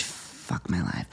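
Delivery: deflated at the price; dismayed whisper. Real, not acted.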